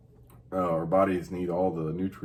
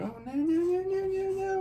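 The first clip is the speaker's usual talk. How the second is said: cooing. Retold or imitated. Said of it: "I'm like".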